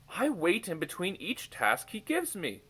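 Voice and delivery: whispered with a sneer; scornful whisper. A narrator's reading. Moggins scoffs at the king's choice, whispered scorn. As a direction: hiss it out with contempt. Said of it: "his voice scornful".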